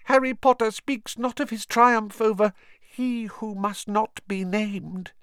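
an awed little elf, squeaky and eager; house-elf Dobby. A narrator's reading. Dobby praising Harry's greatness, high squeaky elf voice.

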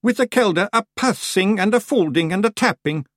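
high, dramatic warning of doom. A narrator's reading. Rob terrifying the Feegles about the Kelda, voice of doom. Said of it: "in a voice of doom".

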